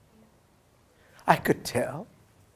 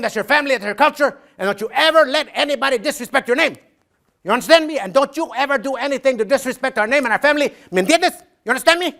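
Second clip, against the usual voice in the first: a father's lecture climbing higher and higher; stern father; 7 semitones higher, 3 dB louder than usual. Retold or imitated. Dad warning his young son never to forget the name, rising stern voice. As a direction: build from firm to fierce, pitch rising all the way.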